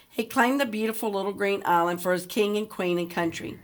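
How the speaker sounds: usual speaking voice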